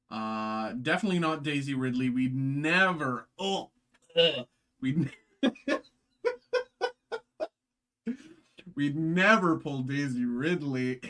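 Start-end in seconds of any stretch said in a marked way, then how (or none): 2.1-11.0 loud, laughing mock snub; laughing joke. Real, not acted. Vlogger teasing viewers about the card, laughing. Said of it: "I'm kidding".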